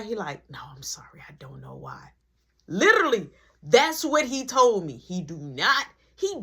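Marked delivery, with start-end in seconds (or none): 0.5-2.1 soft breathy whisper with no voice; teacher whispering an apology to the student. Retold or imitated. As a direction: whisper it so the class can't hear. Teacher quietly tells him.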